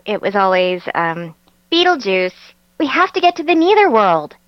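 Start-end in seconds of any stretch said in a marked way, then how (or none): 1.7-4.4 put-on higher, nasal teen Lydia; lightly nasal Lydia imitation. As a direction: pitch it up through the nose like Lydia. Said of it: "she's just a little bit nasalier".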